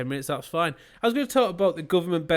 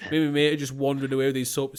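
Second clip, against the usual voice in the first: usual speaking voice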